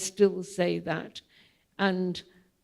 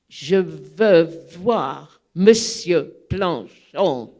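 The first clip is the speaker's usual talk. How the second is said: slower and louder, firmly insisting; slow, louder, stubborn French demand; insistent repeat; 3 semitones lower, 5 dB louder, slower than usual. Retold or imitated. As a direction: plant your feet and insist. Insisting again after refusal.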